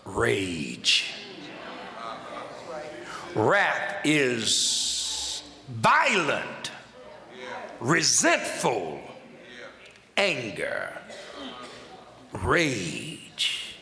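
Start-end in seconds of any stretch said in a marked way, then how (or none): 3.3-13.8 very slow, low-pitched word by word; "violent" drawn out, then sink low; solemn pulpit pauses between words; weighty, deliberate. Shown as a demonstration. Repeats definition, weighting each word.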